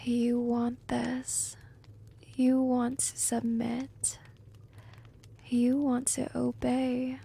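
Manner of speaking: ordinary talk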